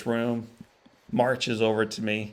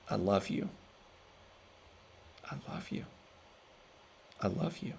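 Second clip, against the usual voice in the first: fading from soft to a bare whisper; a father's tender words, dying to a whisper; 3 semitones lower, 12 dB quieter than usual. Retold or imitated. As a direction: say it gently, each time softer. Father's love voiced in the story.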